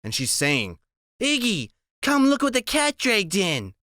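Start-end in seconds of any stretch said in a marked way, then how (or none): 1.2-3.7 high, teasing woman's voice; high mocking welcome from the doorway; Loretta's voice. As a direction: sing it out high and sly, greeting them at the door. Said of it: "Loretta Lee is standing in the light".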